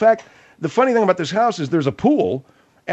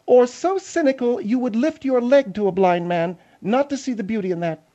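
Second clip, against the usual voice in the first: higher, self-important woman's voice; Margaret; 9 semitones higher than usual. Acted. Margaret defending the house's beauty to Phil, lofty and offended. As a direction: pronounce judgment as a haughty, higher-voiced lady.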